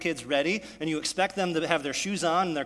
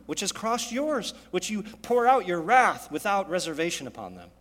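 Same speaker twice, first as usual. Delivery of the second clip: usual speaking voice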